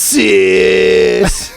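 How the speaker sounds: bully taunt. Pretend.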